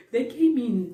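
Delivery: normal talking voice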